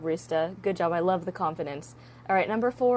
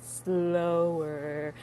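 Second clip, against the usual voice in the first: "slower" stretched out long and low; slow-motion, lower-pitched "slower"; exaggeratedly slow; 4 semitones lower, 3 dB quieter than usual. Shown as a demonstration. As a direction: drag out the word "slower". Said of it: "Let me talk slower".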